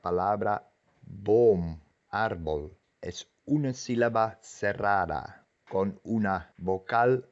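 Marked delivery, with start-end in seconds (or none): none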